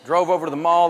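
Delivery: ordinary talk, nothing marked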